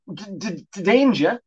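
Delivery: frightened stammer on danger. Acted. Jeremy stammering in fright at the cat. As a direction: trip over the d, scared. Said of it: "stammered Jeremy".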